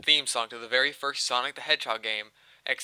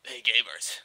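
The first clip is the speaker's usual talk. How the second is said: fake-friendly mascot greeting; lightly voiced cartoon hero; playful, slightly off game character; Sonic the Hedgehog; 2 semitones lower than usual. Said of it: "trying to sound like Sonic the Hedgehog".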